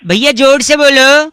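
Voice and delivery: loud call to speak up; shout. Pretend. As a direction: yell it over the line. Prank caller demands shouting.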